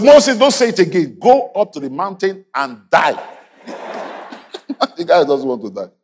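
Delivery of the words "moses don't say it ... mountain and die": preached command. Acted. Preacher voices God's command to Moses, high and commanding. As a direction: preach it as a sternly issued order.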